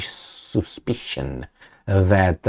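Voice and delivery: normal talking voice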